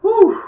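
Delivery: a huge high whoosh of relief; loud relieved whew. Real, not acted. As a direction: blow out all the tension at once. Relief after scary moment.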